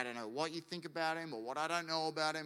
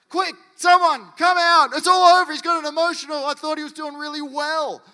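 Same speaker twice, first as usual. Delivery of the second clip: high, hammed-up panicked shouting; mock panic; 12 semitones higher, 20 dB louder than usual. God supposedly freaked out by emotional issue, mock-panic shout. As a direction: yell for help in exaggerated alarm.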